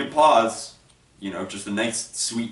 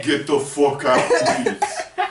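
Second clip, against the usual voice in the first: mock blunt dismissal; much slower than usual. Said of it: "without saying".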